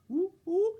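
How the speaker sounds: high whooping test howl; mic test howl. Acted.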